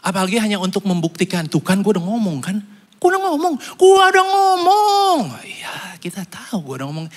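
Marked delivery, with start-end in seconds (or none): 1.5-5.2 smug, rising taunt, much higher; mock told-you-so. Preacher mimics a smug friend: higher, needling told-you-so. 5.5-7.1 hushed, knowing aside; whispered aside. Real, not acted. Preacher confides to the congregation: whispered aside. Aside on the told-you-so line.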